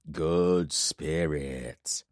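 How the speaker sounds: low, very slow, heavy voice of a giant warlord; menacing warlord from the shadows. Acted. Giant warlord speaks to Corbin from the shadows, low and slow. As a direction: let each word drop slow and low like a giant's threat.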